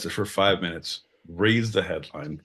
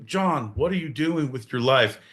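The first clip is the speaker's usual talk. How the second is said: normal talking voice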